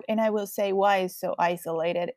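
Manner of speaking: ordinary delivery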